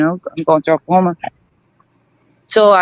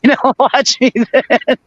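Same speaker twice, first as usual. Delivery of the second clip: slow, higher, laughing through the words; laughing retort; 5 semitones higher, much slower than usual. Real, not acted. Storyteller quotes own comeback to the cop, laughing. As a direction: crack up while giving the comeback.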